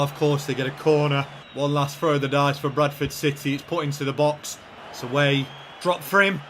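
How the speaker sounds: ordinary talk, nothing marked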